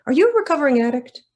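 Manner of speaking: loud, high, fast mock questions; mocking interviewer. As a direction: parody the prying interviewer.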